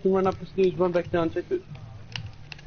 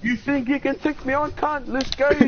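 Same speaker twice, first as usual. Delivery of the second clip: hyped taunt; 9 semitones higher than usual. Pretend. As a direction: hype yourself up and taunt him. Taunting teammate, challenge.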